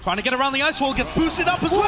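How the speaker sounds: loud, high call climbing to a peak; excited shout. Real, not acted. Caster calls a boost around the ice wall, shouting excitedly. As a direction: shout the big play as it happens. Calling a sudden big play.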